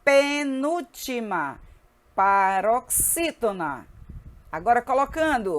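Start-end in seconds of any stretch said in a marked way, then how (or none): 0.0-3.9 "Penúltima", "paroxítona" very slowly, syllable by syllable; repeated syllabic drill. Shown as a demonstration. Teacher repeating once more for the class, slow drilling. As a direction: stretch both "Penúltima" and "paroxítona" for learners. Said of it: "Repetindo mais uma vez".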